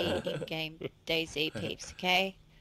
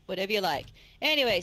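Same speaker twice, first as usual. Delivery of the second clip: ordinary talk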